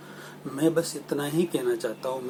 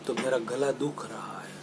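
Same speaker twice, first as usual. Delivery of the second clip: croaky whisper, as if the throat is worn out from shouting; imitating a sore throat. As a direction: rasp it out like your voice is gone. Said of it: "बहुत चिल्ला कर बोलना पड़ा". (गले में दर्द जैसी फुसफुसाहट)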